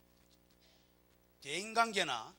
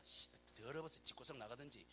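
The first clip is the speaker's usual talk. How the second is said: muttered grumble; 5 semitones lower, 11 dB quieter than usual. Retold or imitated. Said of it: "남편이 먹으면서".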